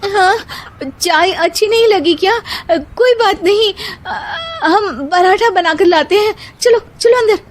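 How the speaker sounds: put-on high, bright young woman voice; eager bahu. A narrator's reading. Bahu offers parathas to Sharma ji, high eager voice. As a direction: hurry to please the guest.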